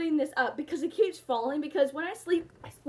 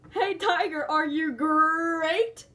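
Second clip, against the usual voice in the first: mocking joke voice; 4 dB louder, slower than usual. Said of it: "you guys always make that joke".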